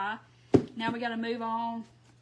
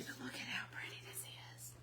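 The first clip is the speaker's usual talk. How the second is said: soft breathy whisper of delight; 18 dB quieter than usual. Real, not acted. Crafter admiring her painted piece to viewers, whispered. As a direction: hush the words as if not to spoil it.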